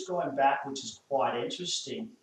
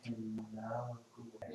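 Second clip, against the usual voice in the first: hushed, gentle hello to the dog; barely above a breath, calming; soft soothing; 3 semitones lower, 15 dB quieter, much slower than usual. Real, not acted.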